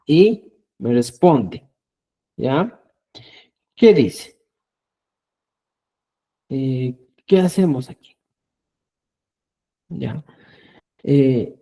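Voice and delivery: usual speaking voice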